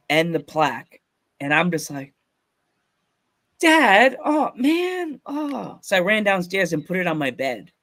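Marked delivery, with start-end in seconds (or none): 3.6-5.8 overwhelmed exclamation. Retold or imitated. Son to dad on seeing the plaque, high and overwhelmed. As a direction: re-enact being overcome by a gift. His reaction to getting the plaque.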